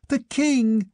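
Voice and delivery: high shriek of recognition. A narrator's reading. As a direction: scream out the discovery. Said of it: "shrieked Albiona".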